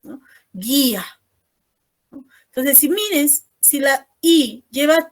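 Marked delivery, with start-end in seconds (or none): 0.5-1.0 "Guía" said GHEE-a, with silent u; only two vowels sounded. Shown as a demonstration. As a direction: model "Guía" with hard g and no u sound. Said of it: "pero solo pronuncio dos". ("Guía" sin pronunciar la u)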